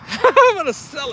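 high, giggly repeat; tickled, laughter in the words; laughing. Real, not acted. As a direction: say it through a laugh. Laughing repeat of plan to sell.

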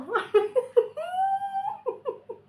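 high laugh breaking into a cry; shocked laugh-cry. Real, not acted. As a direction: laugh and cry at the same time in surprise. Finds money in gift. (tawang may halong iyak sa gulat)

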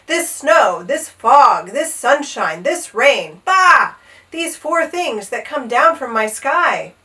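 loud, punched list of complaints; angry shouting. A narrator's reading. King railing at the sky, angry shouting. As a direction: bellow each grievance as a storybook king. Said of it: "he started shouting".

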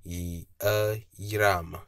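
"Yi Aram" drawn out, sliding down on "Aram"; downward slide tone. Shown as a demonstration. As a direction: let "Aram" glide slowly downward. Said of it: "It's a slide".

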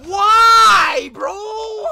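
exasperated shout. Real, not acted. Gamer yells in frustration.

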